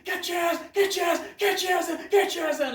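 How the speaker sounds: high, loud drill-instructor yell; screaming drill sergeant. Retold or imitated. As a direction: bellow like a furious drill sergeant at recruits. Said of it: "they're screaming".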